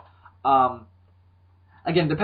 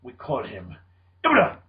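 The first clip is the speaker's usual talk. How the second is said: Ken Watanabe impression; 6 semitones lower than usual.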